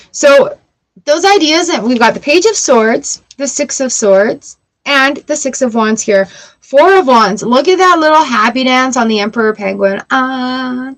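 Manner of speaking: usual speaking voice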